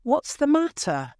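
high-pitched, alarmed question; crying out. A narrator's reading. Husband startled in bed by his wife, crying out. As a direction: cry out in alarm, pitched well up.

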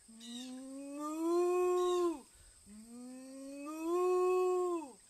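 long, loud swelling moo; cow moo. Acted. Cow asks the librarian for something, mooing loudly. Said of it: "the cow again repeated herself".

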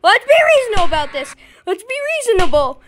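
high, fast, half-crying plea for mercy; hammed-up innocent child begging; mock pleading. Player begging the teacher for mercy, hammed-up high plea. Pleading with Baldi while caught.

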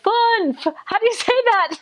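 exasperated companion. Retold or imitated. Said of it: "And we were like".

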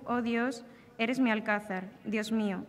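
ordinary talk, nothing marked

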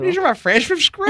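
high squealing laugh through words. Acted. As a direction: giggle the line out loud. Joking mispronounced word.

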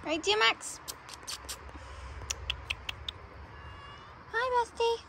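high, sweet pet talk. Real, not acted. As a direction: coo to the cat. Talking to the cat.

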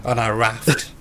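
measured mock documentary narration; survival documentary narrator. Player narrating the raft trip as a survival episode, mock-solemn. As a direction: recount it like a grave survivor interview, voice sinking at the ends. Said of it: "I Shouldn't Be Alive episodes".

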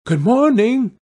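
high sing-out greeting; bright-pitched hail; calling out. Acted. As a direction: call into the house as a little girl. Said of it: "She called out".

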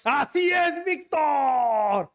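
high, drawn-out celebratory yell; triumphant shout. Real, not acted. Cohost cheers the right guess about the voice, triumphant shout. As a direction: shout it out like a winning answer.

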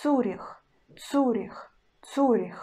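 Zurich said with hard ц then у; hard ц demo. Shown as a demonstration. Teacher repeats Zurich for learners, hard ц. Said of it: "and ц still hard".

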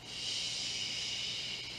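soft whispered shh; wind hush. Shown as a demonstration. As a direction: breathe it like wind in leaves.

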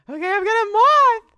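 loud, high, rising squeal of glee; excited squeal. Real, not acted. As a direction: burst out with thrilled, high-pitched shouting. Excited find in game.

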